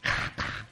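KA, KA as voiceless throat rasps; just the throaty k of KA, softly; guttural k sounds. Shown as a demonstration. Likening the name to German, soft guttural KA, KA. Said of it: "Sounds like German".

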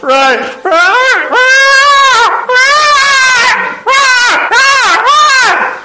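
very high, loud, straining howl; dog howling Radhe. Retold or imitated. The dog trying to chant for the speaker, as a very high loud howl. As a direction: howl it out as if grinding out the word. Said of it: "puts his head down and goes".

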